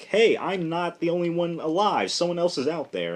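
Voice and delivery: lifted high, mock relieved cry; relieved excitement. Reviewer voicing Hawkman's relief, lightly excited. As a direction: sound delighted you're not alone. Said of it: "he's relieved".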